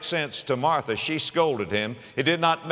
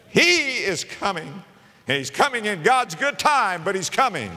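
rising proclamation; 8 semitones higher, 5 dB louder than usual. Acted. Preacher proclaiming to the congregation that he is coming, high and loud. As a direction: ring it out to the back row. Loud repeat after quiet.

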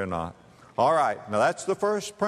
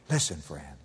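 ordinary talk, nothing marked

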